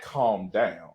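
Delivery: low, soft, soothing drone; imitating calm boyfriend. Boyfriend calming partner in a low soothing drone. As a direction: settle her down, deep and hushed. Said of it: "when they hear that".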